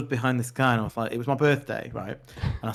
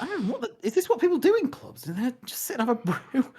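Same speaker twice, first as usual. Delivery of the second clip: high mock-baffled voice, pitched well up; high, squeaky disbelief; incredulous friends; 10 semitones higher than usual. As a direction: play the baffled mate hearing about tea in a club. Friends voiced asking about club tea.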